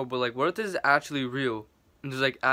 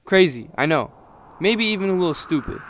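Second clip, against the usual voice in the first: loud; 10 dB louder than usual. Real, not acted. Vlog intro while being chased.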